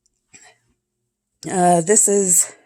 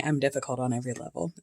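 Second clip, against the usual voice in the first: fast, much softer throwaway repeat; quick quiet aside; 4 semitones lower, 12 dB quieter, much faster than usual. Shown as a demonstration. Repeating the quote to herself as a soft, quick aside. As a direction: toss "I'm difficult on every level" off under her breath, quickly.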